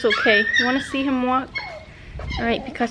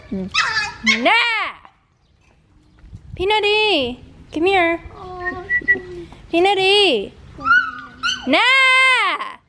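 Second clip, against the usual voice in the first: calling the dog; 8 semitones higher, slower than usual. Real, not acted. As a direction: call the dog, then yell.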